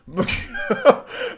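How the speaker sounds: high-pitched burst of laughter. Real, not acted. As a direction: laugh at the absurd question. Laugh at viewer's question.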